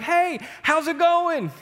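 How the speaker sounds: high, slow, carefree hello; sing-song "hey, how's it going" as if nothing happened; breezy cheerful greeting. Retold or imitated. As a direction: act oblivious and chipper to your wife. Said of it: "I go to my wife and I'm like".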